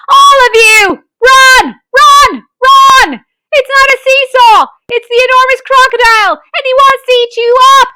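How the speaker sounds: high, loud, fast alarm; frantic monkey yelling; shouted warning. A narrator's reading. Muggle Wump warning the children: loud high frantic shouts. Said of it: "shouted to the children".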